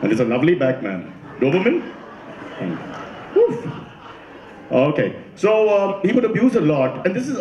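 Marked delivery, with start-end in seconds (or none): 3.4-3.7 high-pitched woof; dog bark. Acted. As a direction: imitate a dog's bark.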